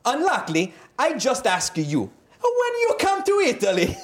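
put-on hammy Italian accent, pitch way up. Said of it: "I'm gonna do another accent".

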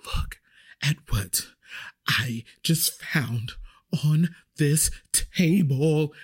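slow, halting stage whisper; mock panicked whisper. Customer secretly showing the waitress a slug, hammed-up whisper. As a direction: imitate a customer whispering word by word. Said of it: "she starts whispering".

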